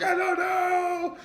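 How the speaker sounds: panicked fan. Acted. Acting out Chiefs fans freaking out, high slow wailing panic. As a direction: stretch it out in a high, crying panic. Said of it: "We're like".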